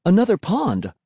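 high-pitched call of discovery; excited cry. A narrator's reading. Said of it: "I cried".

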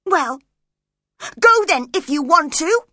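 loud and high, flinging him away; defiant cry. A narrator's reading. Catherine shouting at Edgar to leave, loud and high. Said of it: "she cried".

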